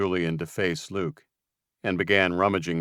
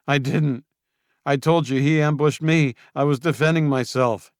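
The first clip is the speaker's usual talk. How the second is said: high, loud defensive protest; Julian protesting; 8 semitones higher, 6 dB louder than usual. Acted. Julian protesting to Luke, high and indignant. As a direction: insist he was only defending himself.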